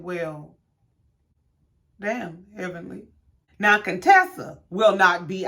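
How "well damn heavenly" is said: stunned quiet. Retold or imitated. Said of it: "I said".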